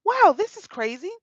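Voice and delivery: high, loud disbelief; amazed. Retold or imitated. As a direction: wow it out, floored by the omen. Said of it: "I'm like".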